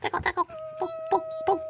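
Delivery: very high cooing; baby talk. Acted. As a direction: squeak it at the pet. Baby talk tickling cat.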